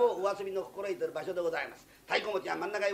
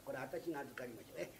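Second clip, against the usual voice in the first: normal talking voice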